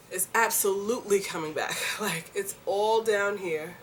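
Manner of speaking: ordinary talk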